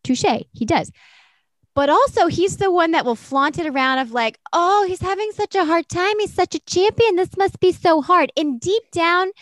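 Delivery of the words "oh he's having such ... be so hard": high, sugary mock pity; fake sympathy. Rosberg faking pity for Hamilton, high and mocking. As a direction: ham up false concern.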